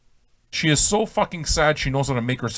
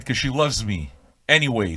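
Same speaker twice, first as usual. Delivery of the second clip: usual speaking voice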